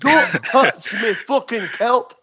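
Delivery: high, slow mock Scottish accent; drawn-out cartoon Scot. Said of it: "I'm not gonna attempt that accent".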